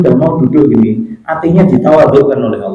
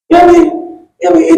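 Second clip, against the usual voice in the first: put-on child answering father; 14 semitones higher than usual. Son answers father's wake-up call.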